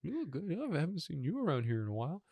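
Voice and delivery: high, soft pickup line; flirty bear voice. Pretend. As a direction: coo it like a bear hitting on someone. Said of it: "that bear is like".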